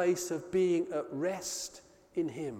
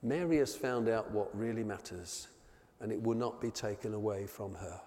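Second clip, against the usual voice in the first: gentle rebuke; 9 semitones lower, 9 dB quieter than usual. Acted. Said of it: "Jesus looks up at Martha and says".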